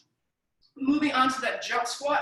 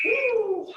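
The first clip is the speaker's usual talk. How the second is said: excited whoop; 11 semitones higher than usual. Real, not acted. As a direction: whoop to pump the class up. Coach hypes the class.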